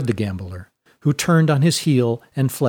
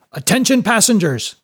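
high, raised announcement; calling to attention; 8 semitones higher, 4 dB louder than usual. Acted. Mate addressing anxious passengers in the saloon, loud call. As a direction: call out over a crowd.

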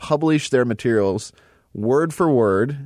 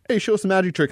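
higher-pitched imitation of someone asking; mock eager request; 9 semitones higher, faster than usual. Onlooker asking the shy magician for a trick, mock eager. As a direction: put on a keen onlooker's call. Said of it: "all of a sudden it's like".

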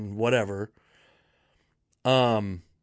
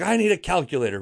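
raised-pitch mock yell of an old boss; 6 semitones higher, faster than usual. Old boss demanding help from her staff, mock yelling. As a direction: call it out high, imitating a yelling lady. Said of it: "this old lady is yelling".

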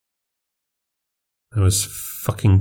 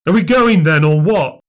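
put-on a child's shout, higher and louder; impatient shout; 9 semitones higher, 4 dB louder than usual. Boy yelling from the hall to his mum, loud and impatient. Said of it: "Liam shouted".

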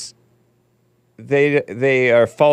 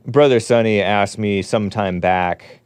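ordinary delivery

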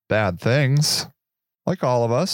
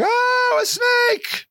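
mock panicked shriek; much slower than usual. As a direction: squeal it like a terrified tourist. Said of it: "And the people were like".

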